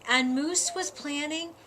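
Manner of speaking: usual speaking voice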